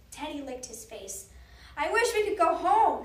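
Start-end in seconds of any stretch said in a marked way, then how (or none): 1.8-3.0 loud, high urgent wish; shouting. A narrator's reading. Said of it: "shouted Annie".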